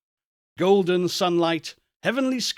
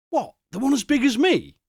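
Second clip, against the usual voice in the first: put-on astonished boy; 8 semitones higher than usual.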